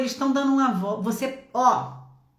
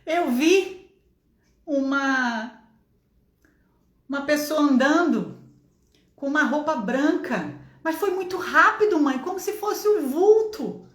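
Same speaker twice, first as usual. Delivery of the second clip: high, halting start, then more urgent; imitated startled child; 5 semitones higher than usual. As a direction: play a daughter unsettled by a strange sighting. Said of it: "Você tá assustada?".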